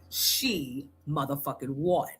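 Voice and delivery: slow, drawn-out pauses, dropping to a hushed sigh; slow dramatic reveal. Retold or imitated. Wife revealing the problem to the therapist, slow and dramatic. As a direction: savour each word of the punchline. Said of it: "I said".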